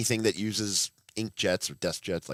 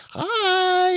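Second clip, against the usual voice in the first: very high, sing-song "Hi."; high chirpy mom hello; mom impression; 6 dB louder than usual. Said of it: "She's like".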